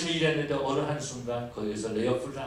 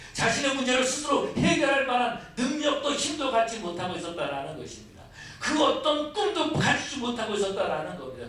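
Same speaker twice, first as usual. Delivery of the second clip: building, fervent sermon climax; fiery preaching; 11 semitones higher, 6 dB louder than usual. Acted. Pastor denounces the grumbling Israelites, fervent preaching. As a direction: drive the point home to the congregation.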